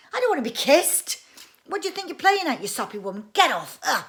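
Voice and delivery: put-on outraged little boy, shouting; indignant boy voice. Boy splutters, refusing kiss.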